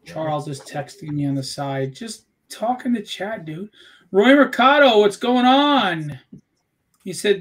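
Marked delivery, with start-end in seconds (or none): 4.1-6.2 loud, higher, drawn-out hail; calling out a greeting. Real, not acted. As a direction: hail a viewer loudly, stretching the end. Hailing a viewer in the chat.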